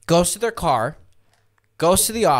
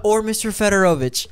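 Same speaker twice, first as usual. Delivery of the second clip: higher, laughing mock-polite flight attendant; lightly put-on prim stewardess voice, giggling; mimicking the stewardess; 7 semitones higher, 4 dB louder than usual. Retelling the stewardess guessing his name, laughing and higher. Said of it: "and she goes".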